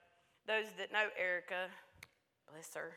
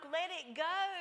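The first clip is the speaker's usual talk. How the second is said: higher, louder retold urging; fervent encouragement; 8 semitones higher, 5 dB louder, slower than usual. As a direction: urge the child to release it all. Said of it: "I'm like".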